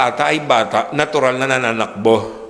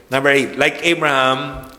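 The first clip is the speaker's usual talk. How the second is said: ordinary talk, nothing marked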